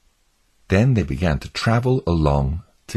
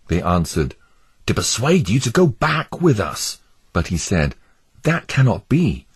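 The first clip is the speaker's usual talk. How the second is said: higher, earnest pleading pilgrim voice; 7 semitones higher than usual. Acted. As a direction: beg your neighbours to flee the doomed city.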